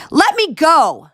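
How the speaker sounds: high loud yell. Acted. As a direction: struggle free, shouting. Struggling in guard's grip.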